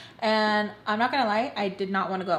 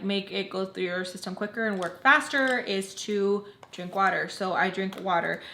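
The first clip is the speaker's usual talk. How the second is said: normal talking voice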